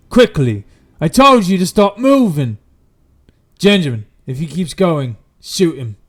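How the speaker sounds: loud, urgent orders; commanding shout. Acted. Guard telling his men to shoot the walker, commanding shout. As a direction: bellow orders to your men.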